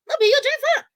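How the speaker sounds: high, loud, pointed comeback; sharp, high rhetorical retort; excited retort. Real, not acted. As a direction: fire it back high and loud.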